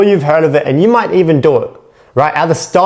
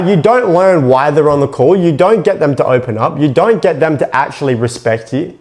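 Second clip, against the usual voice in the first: usual speaking voice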